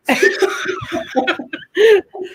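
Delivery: high burst of real laughter; laughing. Real, not acted. Host laughs because her friend is trying not to cry, high laughter. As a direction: crack up in a run of giggles. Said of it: "which is why I started laughing".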